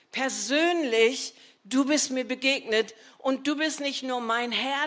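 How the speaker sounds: ordinary talk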